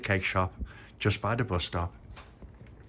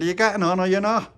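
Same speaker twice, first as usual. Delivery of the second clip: conductor calling; 11 semitones higher, 8 dB louder than usual. Acted.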